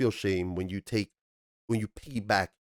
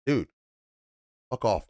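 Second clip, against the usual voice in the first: ordinary talk, nothing marked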